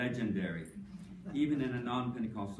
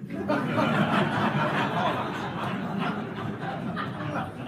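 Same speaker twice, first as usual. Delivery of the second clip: high, breathy burst of laughter; real laughter; loud breathy laugh; 12 semitones higher, 8 dB louder than usual. Real, not acted. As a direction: laugh at your own quip. Laughter after daily lectureship joke.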